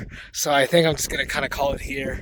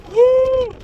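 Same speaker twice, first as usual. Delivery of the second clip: high, loud cheer of joy; excited whoop; 5 dB louder than usual. Real, not acted. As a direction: let out a happy whoop. Cheering on top of dune.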